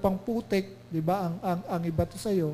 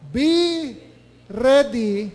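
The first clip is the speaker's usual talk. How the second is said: "Be" punched high and loud, "ready" loud; stress on Be ready; 5 semitones higher, 8 dB louder, much slower than usual. Shown as a demonstration. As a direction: hit "Be ready" hard. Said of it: "Anong sabi niya ulit?". (diin sa "Be ready", mataas at malakas)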